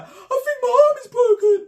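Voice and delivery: put-on high, tearful wail of a hurt girl; mock crying. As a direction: play it up as a sobbing victim. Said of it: "She's like".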